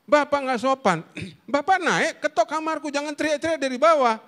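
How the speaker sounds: high, loud indignant complaint; angry child. Retold or imitated. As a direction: imitate a cross child telling Dad off. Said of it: "marah dia".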